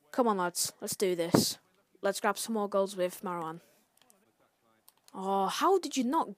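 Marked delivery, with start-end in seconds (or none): none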